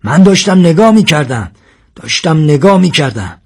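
high, loud, insistent outburst; roared protest. A narrator's reading. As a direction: roar your excuse, then repeat it. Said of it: "و قرید و گفت".

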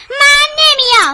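high, loud, drawn-out refusal; yelled refusal. Acted. Child refusing brother over moving away, yelling. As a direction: dig in and yell it. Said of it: "ای داد نزنا".

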